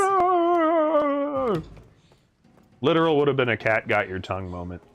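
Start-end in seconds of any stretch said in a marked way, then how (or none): 0.0-1.6 long, high held dog howl; hound's howl. Retold or imitated. Telling how the dog screamed in the cat's face, high imitated howl.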